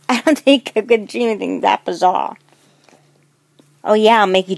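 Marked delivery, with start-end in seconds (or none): 0.1-2.4 laughing. Acted. Laughing while teasing friend.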